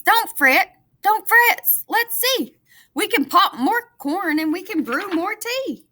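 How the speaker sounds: high, bright little mouse voice; squeaky mouse. Acted. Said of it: "Mouse squeaks".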